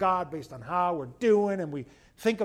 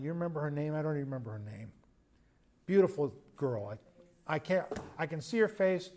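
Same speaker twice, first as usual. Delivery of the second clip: ordinary talk, nothing marked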